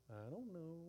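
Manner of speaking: soft sheepish dodge; mild guilty evasion; evasive. Retold or imitated. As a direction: mumble it to avoid blame. Adam evading God.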